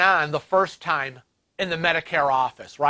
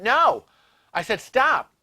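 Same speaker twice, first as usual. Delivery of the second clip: 1 retold sharp stop; 6 semitones higher, slower than usual. Arguing with rude phone agent.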